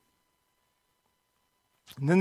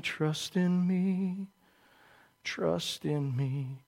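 slow, hushed, hypnotic snake croon, much softer; drawn-out, soft crooning serpent; Jungle Book snake; 2 semitones lower, 11 dB quieter, much slower than usual. Retold or imitated. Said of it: "that snake from, from Jungle Book".

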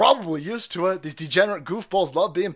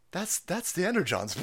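normal talking voice

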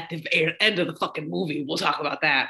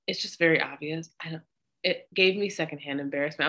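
normal talking voice

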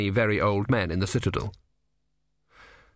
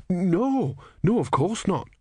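high, hurried nervous protest; hasty denial; 9 semitones higher, slower than usual. Acted. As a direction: deny it quickly in fear. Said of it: "said Brother".

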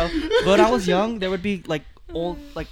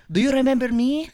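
put-on Filipino-accented auntie, raised pitch; 5 semitones higher, 4 dB louder than usual. Filipino lady coming up to a child at church, Filipino accent. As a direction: imitate a Filipino lady's eager question. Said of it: "Filipino ladies that would come up to me".

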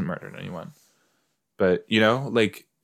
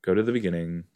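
ordinary delivery

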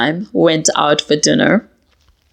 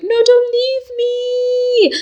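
much higher, slow, whiny don't leave me; clingy plea; much slower than usual. Retold or imitated. After the fight, begging her partner not to leave, mock whine. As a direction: beg them to stay in a mock whimper. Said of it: "I'll be like".

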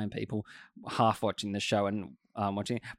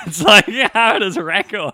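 real laughter, higher and louder; laughing; 9 semitones higher, 14 dB louder than usual. Real, not acted. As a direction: giggle through the words. Friend laughing mid-sentence.

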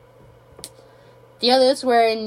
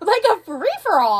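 higher, louder excited repeat; emphatic exclaim; 10 semitones higher, 5 dB louder than usual. Real, not acted. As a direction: hit it again with energy.

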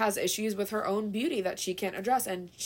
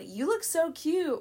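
fake sweet compliment; 8 semitones higher, slower than usual.

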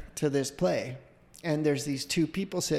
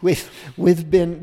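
put-on slow, louder stab at English accent; failed English accent; 3 semitones higher, 9 dB louder, slower than usual. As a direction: fumble a mock English accent. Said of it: "I don't know, I can't do it".

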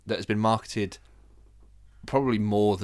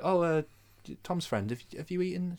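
lightly voiced nan, pitched up; a hint of a gentle nan, higher voice; imitated nan; 8 semitones higher than usual. Nan asking grandson's friend if he's eaten, lightly imitated. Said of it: "she'd be like".

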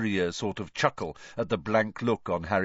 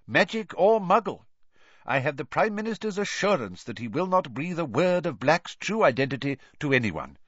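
a lightly voiced pompous minister; Fudge's voice; 9 semitones higher than usual. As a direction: read it like an official statement. Fudge's quoted statement.

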